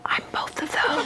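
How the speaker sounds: soft confiding whisper; whispered aside. Real, not acted. Pastor confides to the kids in a whisper.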